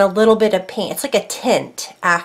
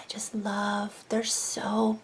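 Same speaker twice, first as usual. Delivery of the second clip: whisper; 13 dB quieter, much slower than usual. Real, not acted. Whispered aside while admiring egg.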